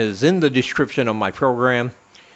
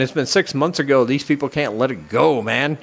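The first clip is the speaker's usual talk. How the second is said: ordinary talk, nothing marked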